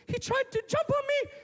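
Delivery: put-on very high little-girl voice; upset daughter. Daughter telling dad about a man, high upset voice. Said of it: "Mike's daughter comes home from school".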